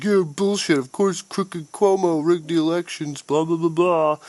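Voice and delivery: high-pitched mock-outraged commenter voice. Ranting about online comments on the election, mocking commenters in a high voice. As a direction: imitate whiny know-it-alls, pitched well up.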